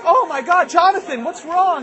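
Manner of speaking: high, loud motherly alarm; gasp-and-fuss voice of a worried mother; alarmed mom. Acted. Mother sees her son bruised, alarmed. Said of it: "Uh, and she's like".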